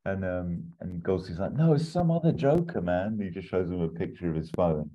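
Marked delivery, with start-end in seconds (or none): 1.5-3.1 put-on English accent. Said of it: "proper English".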